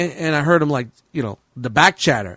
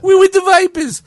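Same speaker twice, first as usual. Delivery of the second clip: higher, louder mock mobster line; lightly voiced mock mob boast; Sopranos gangster; 11 semitones higher, 4 dB louder than usual. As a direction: ham it up like a Sopranos tough guy. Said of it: "it's like Sopranos".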